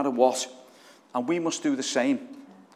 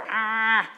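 sudden high, loud cry of frustration; angry cry; 10 semitones higher, 4 dB louder than usual. Shown as a demonstration. Preacher showing the congregation his righteous anger, with a sharp angry cry. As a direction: let out a sharp, exasperated ah. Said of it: "I get angry".

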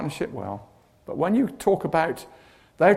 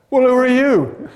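higher, louder startled question; Moses, startled, louder and higher; 7 semitones higher, 6 dB louder than usual. Retold or imitated. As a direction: blurt it out like a frightened man. Moses answers the bush.

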